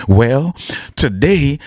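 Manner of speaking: normal talking voice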